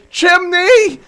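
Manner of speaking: very high, loud jubilant yell; real delighted shriek; overjoyed shout. Real, not acted. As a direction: scream it out in triumph, stretching it. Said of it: "I'm so happy about that".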